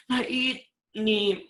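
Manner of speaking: high, slow whiny parent's voice; a parent telling on their child in front of the uncle; complaining parent. Retold or imitated. Parent complaining about the child to the uncle, high and whiny. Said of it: "Kata orang tuanya".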